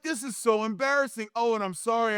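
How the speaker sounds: high-pitched, sheepish retelling; embarrassed apology. Retold or imitated.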